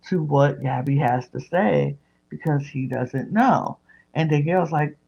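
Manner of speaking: usual speaking voice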